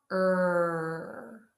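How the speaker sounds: sustained, isolated "Er"; R sound. Shown as a demonstration. Teacher shows class the tongue position, held R sound. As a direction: hold "Er" with the tongue pulled back. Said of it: "let's make the R sound".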